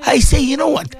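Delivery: high exhortation, climbing to a peak; impassioned preaching. Acted. Urging listeners to become good Muslims, high impassioned preaching.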